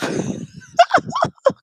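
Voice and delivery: very high squeaky giggle; squealing laugh. Real, not acted. Laughs at own slip.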